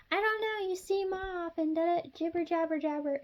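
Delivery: high, sing-song mock of a nosy friend; mock fake concern. Vlogger mocking a gossip who fakes worry, high sing-song mock voice. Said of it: "this person's gonna be like".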